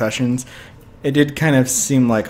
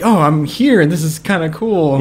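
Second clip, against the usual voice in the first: higher, louder mock enthusiasm; retold pleased wonder; 5 semitones higher, 4 dB louder than usual. Co-host describing the hero's attitude, lightly acted pleased wonder. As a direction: voice someone happy just to be there, pitched up. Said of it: "he just seemed more like".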